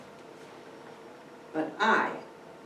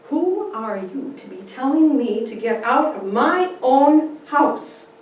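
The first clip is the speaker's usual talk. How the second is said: defiant; 7 semitones higher, 9 dB louder than usual. Acted. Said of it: "looking at him squarely".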